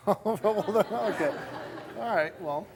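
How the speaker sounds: laughing through the words. Real, not acted. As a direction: chuckle while speaking. Host laughs at her answer.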